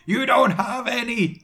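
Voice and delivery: high, mock-mad outcry; mad yell. A narrator's reading. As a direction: yell it madly, pitched high. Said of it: "I yelled in madly".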